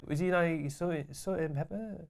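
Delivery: soft, unenthused shop worker; mumbly, indifferent offer; Destiny Harold. Retold or imitated. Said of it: "utterly neutral expression of Destiny Harold".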